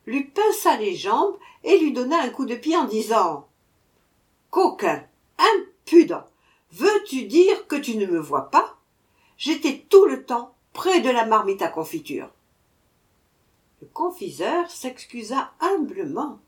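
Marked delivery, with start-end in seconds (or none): 4.5-12.3 loud, higher furious rant; angry dwarf scolding the shopkeeper. A narrator's reading. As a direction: tell off the confectioner hotly. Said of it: "Sir Buzz se mit en colère".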